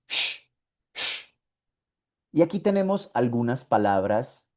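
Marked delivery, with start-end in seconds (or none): 0.1-1.3 "Shh" as a long hushing hiss; sh sound. Shown as a demonstration. Teacher repeating the German sound, hushing sh. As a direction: hiss "Shh" twice, slowly. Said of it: "Ese sonido se usa un montón en alemán".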